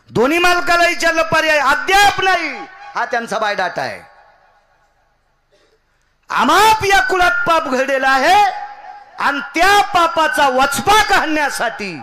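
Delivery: high, shouted proclamation; full-force preaching. Real, not acted. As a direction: thunder it out to the congregation, high-pitched. (पूर्ण जोशात, उंच आवाजात कीर्तन)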